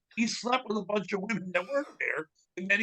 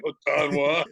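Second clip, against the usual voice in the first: mock teasing; 4 semitones lower than usual. Gang teasing him for flirting, mock teasing. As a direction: mildly lower teasing tone. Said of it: "making fun of him".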